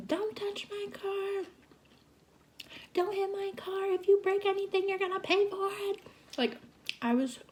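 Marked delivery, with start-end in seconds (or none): 0.1-6.0 much higher, furious warning shouted at a partner; furious retold yelling. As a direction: screech it like you're protecting your car.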